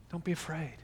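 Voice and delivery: soft, tender reassurance; peace-giving, quiet and loving; loving, gentle. Shown as a demonstration. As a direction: comfort them gently, very softly. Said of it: "but in a loving, gentle, comforting, peace-giving".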